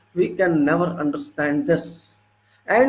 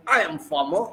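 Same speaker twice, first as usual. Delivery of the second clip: mildly proud self-declaration; proud farmer imitation; 3 semitones lower, 3 dB quieter than usual. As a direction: state the job with quiet pride, imitated. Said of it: "how proudly they say".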